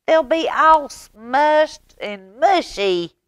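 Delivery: high, loud, slow complaint; big sister wailing at the mess; Ivy fretting. Acted. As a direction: drag out the worry over the squashed bananas. Ivy scolding about bananas.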